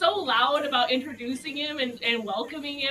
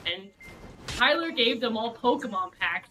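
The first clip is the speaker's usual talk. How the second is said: ordinary talk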